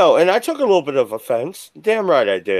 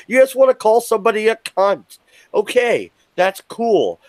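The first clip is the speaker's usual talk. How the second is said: indignant rant, often pitched up; worked-up anger; 3 semitones higher than usual. Real, not acted. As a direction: tear into them, worked up and indignant. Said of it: "I'm worked up".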